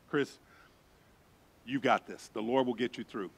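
low, soft encouragement; gently steady a frightened friend, as in prayer; quiet reassurance. Retold or imitated. Gathered to pray for Chris.

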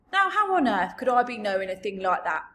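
loud, indignant giant voice; big giant, put out by the question; baffled giant. Acted. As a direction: protest loudly like a puzzled giant. BFG answers Sophie's question.

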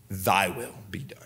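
whispered surrender, low. Acted. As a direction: breathe it out in full surrender. Jesus's prayer, surrendering.

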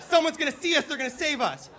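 high, loud, desperate cry; frantic dad. Retold or imitated. Dad firing flares for rescue, frantic yell. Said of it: "starts shooting flare guns in the air like".

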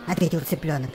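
plain reading voice

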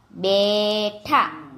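બેઠા very slowly, in two syllables; slow word demonstration. Shown as a demonstration. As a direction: stretch the first syllable of બેઠા. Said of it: "જોડણી ઉપર જોજો".

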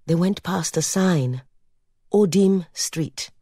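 plain reading voice

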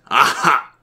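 loud, high cry of triumph; excited shout. A narrator's reading. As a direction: shout it like a sudden discovery. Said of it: "shouted excitedly".

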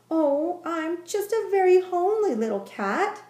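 high, small shy kitten; little kitten voice. A narrator's reading. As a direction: speak it like a modest little cat. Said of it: "said the kitten".

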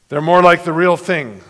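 usual speaking voice

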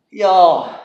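startled yell. Real, not acted. Just scraped ribs.

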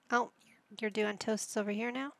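ordinary talk

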